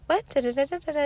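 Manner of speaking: high, babbling imitation of a little kid; mock toddler chatter; toddler parroting back. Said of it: "just kinda parroting back".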